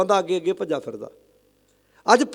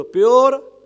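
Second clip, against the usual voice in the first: high, loud call to the congregation; raised, drawn-out cue; loud prompt; 4 semitones higher, 5 dB louder, much slower than usual. Shown as a demonstration. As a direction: punch it out for them to echo. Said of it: "ਉੱਚੀ ਬੋਲੋ".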